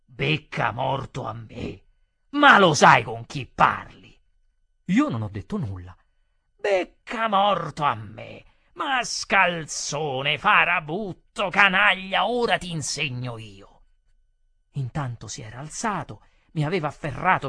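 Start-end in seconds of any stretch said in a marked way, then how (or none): 0.0-4.2 slow indignation building to loud; violent outrage. A narrator's reading. Customer jumping up at the waiter's insult, outraged. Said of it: "saltò su con violenza inaudita". 6.5-13.8 loud, drawn-out furious insults; violent fury. A narrator's reading. Customer threatening to teach the waiter a lesson, furious. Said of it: "saltò su con violenza inaudita".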